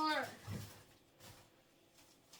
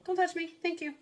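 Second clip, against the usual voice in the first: loud, high scolding to the dog; sharp command; 6 semitones higher, 10 dB louder than usual. Real, not acted. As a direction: snap it out loud and stern. I'm talking to my dog.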